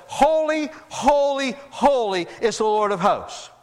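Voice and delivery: high, drawn-out call on each holy. Acted. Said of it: "one called out to the other".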